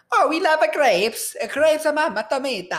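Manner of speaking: high, loud hammed-up Italian accent; over-the-top fake Italian accent, way up high; mock Italian accent. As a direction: squeal it like a cartoon Italian chef. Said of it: "that was a perfect French accent".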